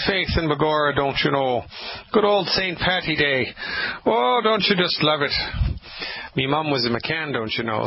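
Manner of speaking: cheery put-on Irish brogue; Irish accent. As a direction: play up a jolly Irish lilt for St Patrick's Day. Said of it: "speaking in an Irish accent".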